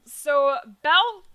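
a burp breaking through the words. Real, not acted. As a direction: say it with a burp coming up. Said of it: "excuse me, I had a burp".

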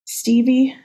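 tearful whimper of his name. Acted. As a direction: whimper it through tears. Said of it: "you whimpered through a fresh wave".

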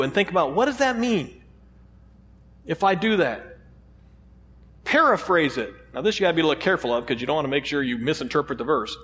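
ordinary delivery

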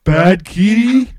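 simpleton hero. Acted. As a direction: drag it out like a confused oaf. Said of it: "look at Stanley and go".

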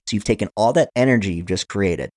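usual speaking voice

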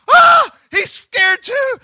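high, slow, hammed-up scream; mock terrified shriek. Disciples seeing Jesus scared, mock shriek. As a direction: wail it like the boat is going down. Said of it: "Then they would have said".

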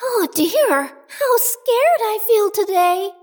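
put-on high, frightened girl voice; small and uneasy, high; scared little girl. A narrator's reading. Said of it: "she said to herself".

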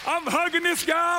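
high, loud, breathless-with-joy storytelling; hammed-up excited shouting; re-enacted elation. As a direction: burst out as if overjoyed. Overjoyed retelling of hugging the man.

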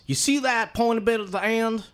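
put-on high, drawling range instructor accent; instructor's accent. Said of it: "unless I do the accent".

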